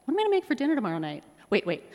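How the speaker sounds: fast rushed wandering thought; racing thought. Retold or imitated. Mom's mind racing mid-prayer, fast and rushed. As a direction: rattle it off as the mind races. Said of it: "it goes a little like this".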